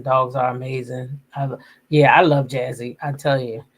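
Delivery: ordinary delivery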